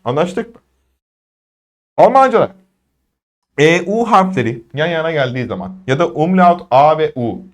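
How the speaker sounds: ordinary delivery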